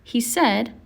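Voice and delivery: ordinary delivery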